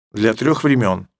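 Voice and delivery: ordinary talk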